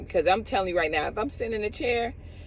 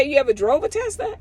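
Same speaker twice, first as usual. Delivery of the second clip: mock pointed question, high; 7 semitones higher, 3 dB louder than usual. Friend pressing her about driving a Tesla, high-pitched. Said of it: "He said".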